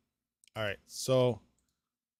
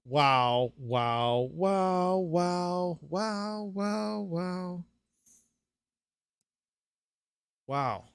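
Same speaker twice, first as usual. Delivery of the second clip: a string of awed wows, rising higher; stunned wows; 4 semitones higher, much slower than usual. Real, not acted. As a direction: let each wow hang in amazement. Speechless at the result.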